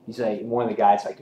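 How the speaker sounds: ordinary talk, nothing marked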